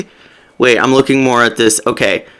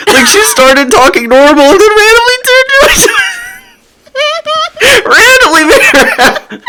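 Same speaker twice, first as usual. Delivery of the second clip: high voice breaking into laughter; helpless giggly retelling; real laughing. Real, not acted. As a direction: crack up while trying to explain. Cracking up over the accent.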